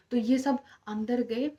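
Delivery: ordinary delivery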